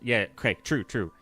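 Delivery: ordinary talk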